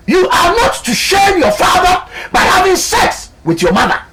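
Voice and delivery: booming high proclamation of scripture; mock biblical prophet. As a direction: thunder out the commandment like a prophet. Preacher proclaims God's law.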